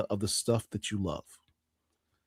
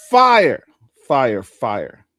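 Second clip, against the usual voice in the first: hyped exclamation; 8 dB louder, much slower than usual. Acted. To the audience, introducing the new cover excitedly. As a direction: hype up the cover art.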